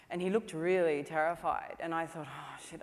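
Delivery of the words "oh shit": much softer, sinking aside; dismayed aside. Retold or imitated. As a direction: think it aloud under your breath. Retold dismayed thought.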